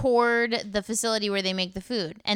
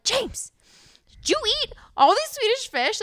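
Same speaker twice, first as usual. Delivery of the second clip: freaking out; 14 semitones higher than usual. Retold or imitated. Mom confronting son over candy, high alarmed shriek. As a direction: shriek the question at the kid. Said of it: "Like, freaking out".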